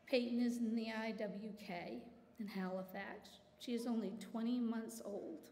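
ordinary talk, nothing marked

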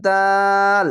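দাল with a very long, level aa; chant-like, drawn-out দাল; three-alif stretch. Shown as a demonstration. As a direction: hold দাল steadily on one note. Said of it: "তিন আলিফ টেনে পড়বেন".

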